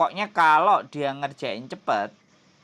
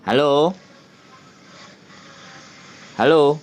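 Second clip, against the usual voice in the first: loud repeated hello; calling out on phone; 5 dB louder than usual. Real, not acted. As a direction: raise voice over a bad line. Calling over bad line.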